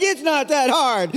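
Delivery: high, slow echo; child echoing dad. Retold or imitated. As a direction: repeat it back as the climbing child. Child echoes dad's holler.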